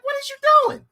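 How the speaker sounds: high, loud incredulous voice; baffled question. Retold or imitated. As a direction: squeak out disbelief. Self voiced confused in story.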